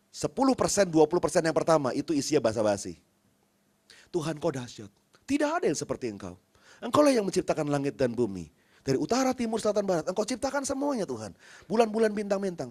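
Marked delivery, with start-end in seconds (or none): 4.1-12.7 mock flowery prayer. Mocking empty flowery prayer.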